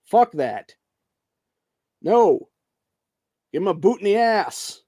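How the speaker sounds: angry yelling. Real, not acted. Vlogger shutting down the idea, angry shout. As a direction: bark it like you're done arguing. Angry dismissal continuing rant.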